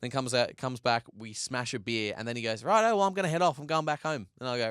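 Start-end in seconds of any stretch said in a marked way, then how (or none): 2.6-4.3 high, casual sign-off, loud at the start; breezy farewell. Retold or imitated. Partner leaving after a beer, imitated breezy cheer. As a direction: imitate a chipper mate heading off.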